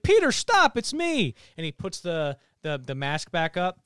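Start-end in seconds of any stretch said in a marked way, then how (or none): 0.0-1.4 high, loud movie-villain plea; mock panicked cry, pitched high; acted desperate plea. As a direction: act out pleading to be spared. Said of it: "Green Goblin's like".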